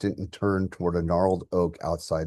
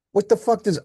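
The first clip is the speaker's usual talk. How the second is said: much higher, loud outraged yell; furious shout; 7 dB louder than usual. Acted. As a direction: blow up in disbelief. Son explodes at father.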